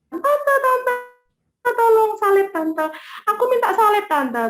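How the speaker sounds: high, urgent begging; re-enacted pleading. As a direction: beg the aunt for help, high-pitched. Re-enacts her begging for a crucifix.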